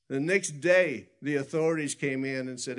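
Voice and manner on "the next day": "the next day" loud and high, drawn out; emphatic repeated NEXT DAY. Real, not acted. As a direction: hammer home "the next day" slowly, falling on day. Repeats phrase for dramatic emphasis.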